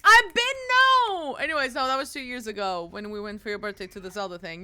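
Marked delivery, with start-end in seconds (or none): 0.0-1.4 drawn-out high whine. Retold or imitated. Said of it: "I was just like".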